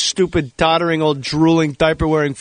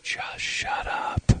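hushed, drawn-out inner plea; breathy, stretched-out muted exasperation; voice in the head; 9 dB quieter, much slower than usual. Retold or imitated. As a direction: say it slowly under your breath. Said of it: "constant thing in my head".

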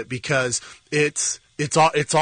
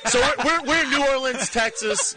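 laughing, high, badly faked New Orleans accent; a lightly voiced, laughing New Orleans twang; mock New Orleans accent; 9 semitones higher than usual. Joining his partner's joke, laughing mock New Orleans accent. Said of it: "that is not a Creole accent".

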